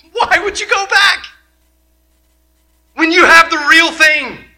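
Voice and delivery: high, loud pleading question; urgent plea. Retold or imitated. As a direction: beg them not to turn back. Author pleading with the readers.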